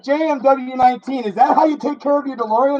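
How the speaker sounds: high-pitched mocking question; mock critic. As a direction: tease like a scolding viewer. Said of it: "it's like".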